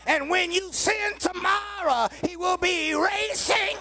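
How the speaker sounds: loud, slow, sung-out preaching with rising pitch; full-force preaching. Acted. Preacher calling the church to rejoice, loud sung-out preaching. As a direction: lift the church with every phrase. Preacher climaxing on eraser image.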